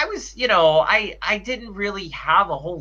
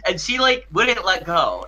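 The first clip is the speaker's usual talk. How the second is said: ordinary talk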